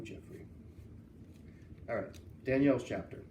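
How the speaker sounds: plain reading voice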